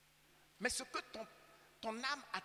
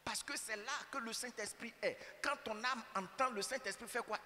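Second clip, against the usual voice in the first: usual speaking voice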